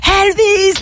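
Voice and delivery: high-pitched fan cry; mock crowd yell. Crowd drowning out the pope, high mock yell. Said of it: "la foule qui gueule".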